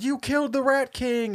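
high, shouted accusation; accusing rat mob. Retold or imitated. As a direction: cry out like angry rats. Rats accuse in retold scene.

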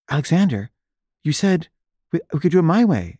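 high-pitched pleading objection; retold plea to a director who broke his word; protesting.

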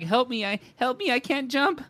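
high, pleading little dog voice; wiener dog voice. Retold or imitated. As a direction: beg like a stubby little dog. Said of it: "they look at you like".

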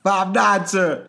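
high, loud recruit's shout; shouted military reply; recruit answering sergeant. Acted. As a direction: snap back to your sergeant.

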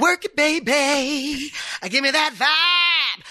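high, slow, drawn-out hype delivery; performed song line. Shown as a demonstration. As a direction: stretch the lyric out high, like a performer. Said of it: "Wait, am I saying it".

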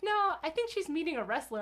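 a hint of an amused father; dad quoting. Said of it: "my dad's like".

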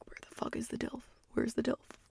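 breathy urgent whisper, barely any voice. Acted. Whispering to her friends while scanning the dock for the dilf. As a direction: lean in and whisper it like a secret search.

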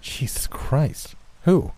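a slow, breathless, horrified gasp of words; aghast, breathless. A narrator's reading. Hearing his friend was nearly scalped, breathless horror. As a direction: let the horror steal your breath.

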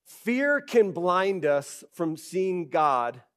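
normal talking voice

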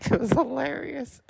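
squeaky high-pitched laugh through the words. Real, not acted. Remembering copying Commando as a kid, laughing. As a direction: laugh it out, tickled by the memory.

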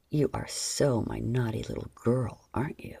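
deep voice fading to a whisper; lightly voiced man, low and teasing. A narrator's reading. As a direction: voice him low, dropping to a breath.

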